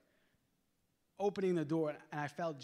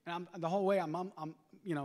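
ordinary talk

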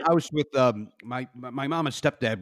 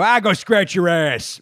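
gruff stepdad line; 4 semitones higher, 6 dB louder, slower than usual. Retold or imitated. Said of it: "my stepdad would go".

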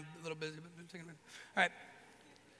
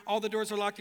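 ordinary talk

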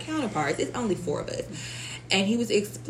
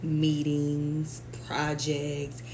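usual speaking voice

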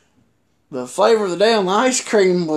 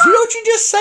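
high-pitched incredulous question; imitated shock; 4 dB louder, much faster than usual. Partner reacting to his outburst, imitated high-pitched shock. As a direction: mimic someone taken aback, pitched way up.

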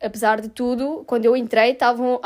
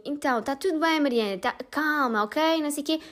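soothing; 6 dB quieter than usual. Retold or imitated. Nurse calming the scared patient.

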